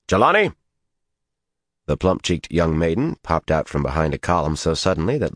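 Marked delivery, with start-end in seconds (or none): 0.1-0.5 loud high call. A narrator's reading. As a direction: call her across the courtyard. Rand calling out to maiden.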